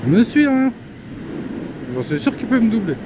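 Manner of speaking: high, fast and tense; racing someone on his tail; being chased. Real, not acted. Racing a scooter rider.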